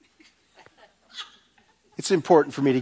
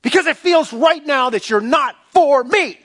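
higher, anguished prayer voice; pleading believer; 8 semitones higher than usual. Retold or imitated. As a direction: confess your doubts to God, strained. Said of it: "come to him and say".

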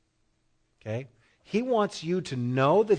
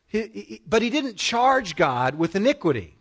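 ordinary talk

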